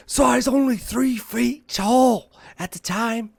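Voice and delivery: high, loud, slow mock Aussie; Australian accent. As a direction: ham up a broad Australian accent, drawn out. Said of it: "I can't do an Australian accent".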